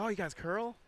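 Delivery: bright, eager question; excited. Retold or imitated. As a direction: ask it like thrilled to meet a curler. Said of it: "He got really excited".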